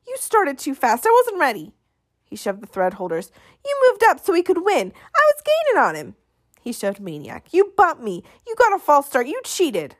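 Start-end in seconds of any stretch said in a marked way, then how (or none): 0.0-6.1 much higher, whining angry complaint; sore loser protest. Acted. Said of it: "his protest". 7.5-10.0 jab each accusation at him; angry accusation. Acted. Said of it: "his protest".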